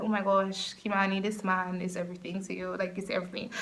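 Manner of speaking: low, very soft murmur to herself; hushed, dreamy aside; smitten inner voice. Retold or imitated. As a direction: confide it quietly, totally smitten. Retells her smitten inner thought.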